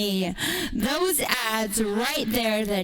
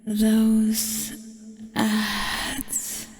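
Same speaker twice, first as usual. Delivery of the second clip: slow, teasing whisper; much slower than usual. Acted. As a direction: lean in and whisper it slowly. Creepy whispered tease to viewers.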